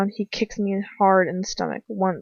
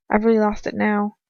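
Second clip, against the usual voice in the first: plain reading voice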